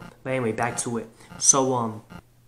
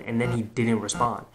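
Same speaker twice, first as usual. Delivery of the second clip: ordinary delivery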